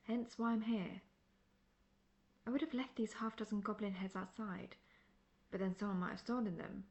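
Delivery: ordinary talk, nothing marked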